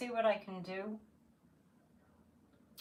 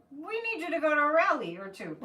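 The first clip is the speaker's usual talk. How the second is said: higher, louder mock insistence; a playful, raised voice for the guides' orders; spirit guides' urging; 6 semitones higher, 5 dB louder than usual. Guides telling her to go to a rally, playful urging. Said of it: "and they're like".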